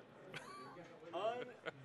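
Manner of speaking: high, helpless chuckling; amused laugh. Real, not acted. Commentator enjoying one player taunting the other, amused laugh. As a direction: laugh along at the teasing.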